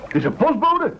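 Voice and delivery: high, eager cry of discovery; excited spotting. Acted. The chef spots the swimmer, excitedly high. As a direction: point him out with high excitement.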